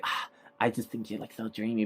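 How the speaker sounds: very soft, airy imitation of a swoon; mock swooning admirer. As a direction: lightly play at being smitten, hushed. Retold admirer swooning over Steve.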